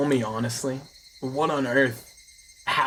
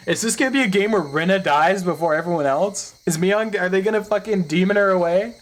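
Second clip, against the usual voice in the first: excited; 7 semitones higher, 5 dB louder than usual. Real, not acted. Excited plot speculation.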